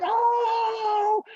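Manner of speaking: long, high, loud jeering "oh"; crowd's "oh". Acted. Hallway kids reacting to the challenge, crowd's "oh". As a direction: whoop like a whole hallway of kids.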